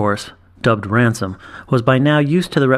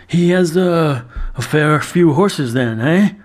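higher, slow and halting, a nervous barman; unsteady voice; 5 semitones higher, slower than usual. A narrator's reading. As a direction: stammer it out, thrown by the gold coin. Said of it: "Handel's voice was unsteady".

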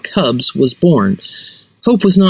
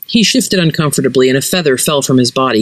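usual speaking voice

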